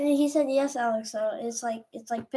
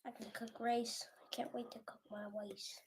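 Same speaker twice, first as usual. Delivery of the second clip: unvoiced whispered aside; 16 dB quieter than usual. Real, not acted. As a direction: breathe it out as a whisper.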